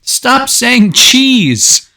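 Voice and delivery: high, loud, very drawn-out shout; exasperated yell. Acted. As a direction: stretch every word in mock fury. Exasperated outburst about repeated cheese jokes.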